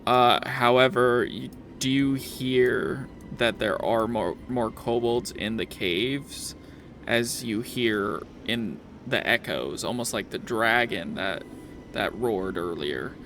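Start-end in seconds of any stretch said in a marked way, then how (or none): none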